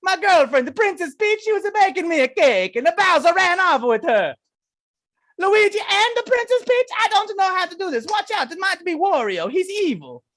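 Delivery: hammed-up high Italian cartoon voice; Mario accent. Demonstrating twenty minutes of gaming, loud panicky Mario accent. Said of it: "going into a Mario accent".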